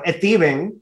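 put-on Stephen as "Esteban", in mom's Dominican accent; "Esteban" with a Dominican Spanish vowel before the S; thick Dominican accent. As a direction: imitate a Dominican mother saying "Esteban" for Stephen. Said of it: "she had a very thick accent".

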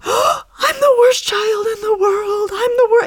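high, loud, slow hammed-up wail; mock child crying. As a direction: wail like a six-year-old melting down. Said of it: "and just starts crying".

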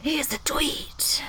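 slow, hushed whisper. Pretend. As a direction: whisper it like a secret. Whispered tweet reveal.

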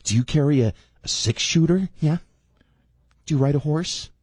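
lightly voiced sneering Beltway type; mock snooty local. Mocking DC locals meeting a Texan.

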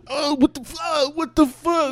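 very high, strangled yelps; panicked wailing. Acted. Chad crying out from inside the punched bag, panicked. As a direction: wail like you're being pummeled.

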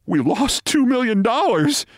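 high-pitched inner alarm; panicked thought. A narrator's reading. Manager reeling from the owner's news, high panicked thought. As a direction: think it in rising panic, voice far up. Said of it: "he thinks to himself".

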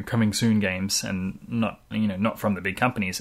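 ordinary delivery

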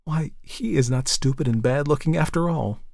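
wondering, lifted-pitch reappraisal; surprised realisation. A narrator's reading. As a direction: discover it with pleasant astonishment. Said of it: "came to the surprising conclusion".

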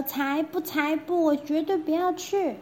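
put-on high, insistent little girl voice; stubborn princess. Princess refusing the prime minister, high and insistent. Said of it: "小公主说".

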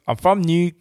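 mock Geordie accent; Geordie accent impression. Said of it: "can you do her voice".

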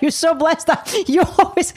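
high, giggly imitation of the manager; laughing mock manager voice. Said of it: "He's like".

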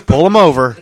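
loud, punched-out command; mock cop order. Voicing what the profiling cop thought, loud mock order. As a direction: imitate an officer calling it in loudly. Voices the cop's thought, calling out.

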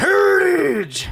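high, slow drawn-out yell; shout. Retold or imitated. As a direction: stretch the yell out high and loud. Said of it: "he'll just be like".

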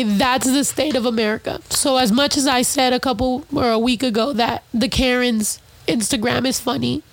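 normal talking voice

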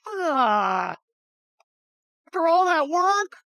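high shout of disbelief; a man betrayed by the weather; outraged ark builder. Retold or imitated. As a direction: cry out in dismay after all the toil. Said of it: "Some guy who's building an ark's going".

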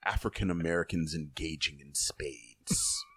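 deep, measured documentary voice-over; nature documentary narrator. Pretend. Co-host teasing about a spades documentary, low mock narrator. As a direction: narrate low, like a wildlife broadcast. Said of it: "You saw a documentary about spades".

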